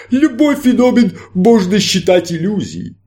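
grand stage magician, inviting; showman magician. Acted. Magician answers.

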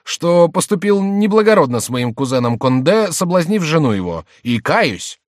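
high, loud theatrical confession; jester posing as penitent. A narrator's reading. Jester confesses the king's sins, loud mock penitence. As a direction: declaim the sins with mock remorse.

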